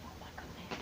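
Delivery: whispered gasp. Real, not acted. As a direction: breathe it in a whisper. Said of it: "aside to listener".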